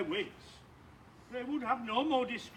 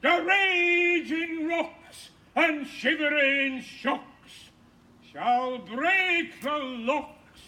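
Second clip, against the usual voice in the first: ranting Hercules; slower than usual. Acted. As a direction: rant it out like a stage tyrant. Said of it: "I could play Hercules big".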